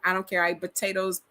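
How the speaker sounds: usual speaking voice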